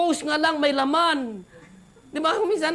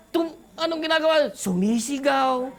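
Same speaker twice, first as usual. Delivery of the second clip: ordinary talk, nothing marked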